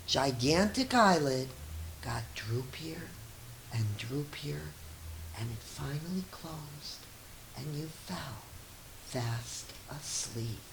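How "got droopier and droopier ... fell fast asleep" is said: softer and softer, into a whisper; hushed bedtime fade to nothing; fading to sleep. A narrator's reading. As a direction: let the words drift off like a lullaby. Eye closing, lulled to sleep.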